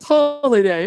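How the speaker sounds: mock British "Holiday" like the Jet2 holiday ad; British accent. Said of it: "the Jet to Holiday is British".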